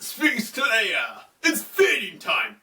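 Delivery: mock villain calling his monster; Anubis. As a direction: call out a hammed-up, theatrical command. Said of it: "he's like".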